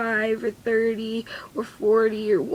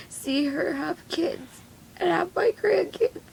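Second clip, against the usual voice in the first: crying; 7 semitones higher than usual. Real, not acted. Mother imagining her daughter's wedding and grandkids, crying. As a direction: voice climbing high as tears take over.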